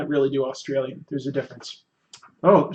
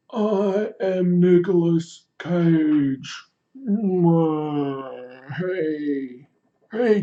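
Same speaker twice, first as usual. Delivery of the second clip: Nicolas Cage; much slower than usual. Retold or imitated. Chat requests a celebrity, streamer does slow hammed-up impression. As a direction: ham up a weird, slow celebrity greeting to kids. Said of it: "Darth Salad says Nicolas Cage".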